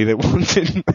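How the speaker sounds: breaking into a laugh mid-word; can't hold back the giggle. Real, not acted. Reader cracking up at the story, laughing.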